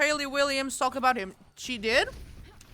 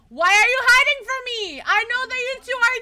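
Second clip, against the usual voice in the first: excited yelling; 12 semitones higher, 10 dB louder than usual. Retold or imitated. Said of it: "I was just like".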